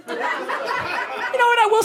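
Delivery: squeaky, drawn-out "you know what"; high mock excitement. Parent gearing up for a wild night, giddy high voice. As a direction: stretch it high like a silly thrill.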